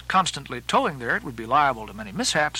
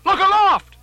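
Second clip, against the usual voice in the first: very high, loud shout; piercing lookout's call; alarmed cry; 9 dB louder than usual. Acted. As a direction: yell up at the masts in alarm. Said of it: "cried Starbuck".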